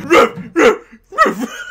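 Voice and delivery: high, loud, silly human-voiced barking; mock dog bark. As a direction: bark like a man pretending to be a dog. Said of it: "a guy voicing the dog going".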